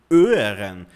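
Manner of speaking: "hören" without its h, opening on a long ö; mispronounced German. Shown as a demonstration. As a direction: say "hören" wrongly and slowly, starting straight on ö. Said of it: "errou o biquinho da pronúncia".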